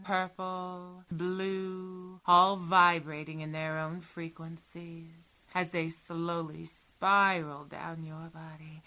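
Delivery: usual speaking voice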